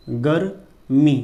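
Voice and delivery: गर्मी drawn out very slowly; slow spelled-out गर्मी; slow dictation. Shown as a demonstration. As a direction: stretch गर्मी as you write. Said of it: "teacher spells while writing".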